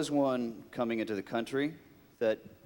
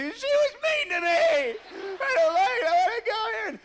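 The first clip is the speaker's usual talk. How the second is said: much higher, louder, sobbing whine; mock crying; 6 dB louder than usual. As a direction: wail like a hurt child. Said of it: "my wife's gonna call".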